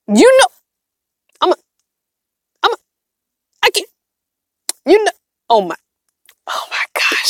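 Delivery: broken, halting starts that fade to a whisper; lost for words. Real, not acted. Streamer reacts to surprise money gift, speechless. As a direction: stammer in short bursts, overwhelmed.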